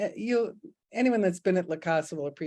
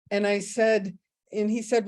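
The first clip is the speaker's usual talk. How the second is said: usual speaking voice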